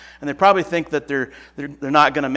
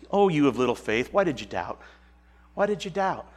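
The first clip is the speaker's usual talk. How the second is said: softer, a father gently scolding his son; Jesus gently rebuking Peter like a father to a son; gentle fatherly rebuke; 6 dB quieter than usual. Retold or imitated. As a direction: chide him quietly and kindly. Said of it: "like a little bit of a rebuke, kinda like a father to a son".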